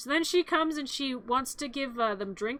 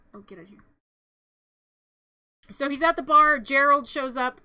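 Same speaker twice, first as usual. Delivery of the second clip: ordinary talk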